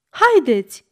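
urgent narrated call. A narrator's reading. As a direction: shout to companions to come along. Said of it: "strigă el la ceilalți".

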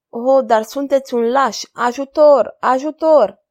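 calling for help. A narrator's reading. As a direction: scream for help, held back a little. Gillette calls for help. (strigăt de ajutor, speriată)

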